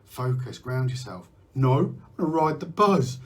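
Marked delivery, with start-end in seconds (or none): 1.5-3.2 defiant buzz. Pretend. Defying advice, buzzing.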